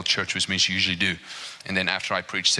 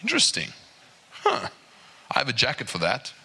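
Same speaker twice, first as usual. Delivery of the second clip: dry shrug of a reply, higher on Interesting; mock unimpressed local; 4 semitones higher than usual. Preacher voicing a tough Alaskan local, dry mock shrug. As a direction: shrug it off like cold is nothing. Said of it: "You're like".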